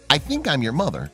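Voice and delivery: plain reading voice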